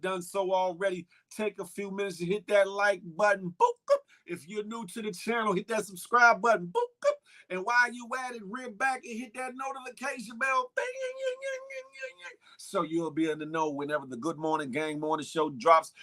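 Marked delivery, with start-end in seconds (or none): 3.6-4.0 high squeaky button boops; boop sound effect. Pretend. Host to viewers pressing like, high playful boops. 6.7-7.1 high squeaky button boops; boop sound effect. Pretend. Host to viewers pressing like, high playful boops. 10.8-12.4 very high bell ring fading away; ringing bell sound. Pretend. Host urging viewers to the notification bell, high fading ring.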